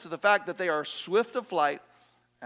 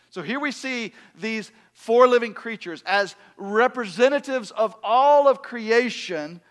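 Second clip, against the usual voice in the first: louder, higher sermon delivery; impassioned preaching; 7 semitones higher, 11 dB louder than usual. Real, not acted. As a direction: preach it with force and conviction. Preaches at full force.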